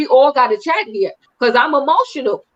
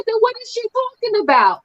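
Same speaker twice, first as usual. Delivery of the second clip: raised pitch mock demand; 8 semitones higher than usual. Karen confronting Candace.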